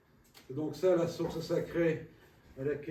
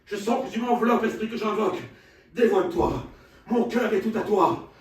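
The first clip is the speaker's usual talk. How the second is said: impassioned shouting; 8 semitones higher, 15 dB louder than usual. Acted. Faust invoking spirit, shouting.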